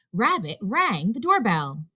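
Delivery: ordinary delivery, nothing marked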